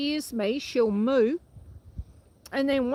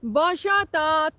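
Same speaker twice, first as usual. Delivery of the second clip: calling the cows; 5 dB louder, slower than usual. Real, not acted. Farmer calling her cow Dot in French. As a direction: call Dot from across the paddock. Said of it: "what's happening to my voice".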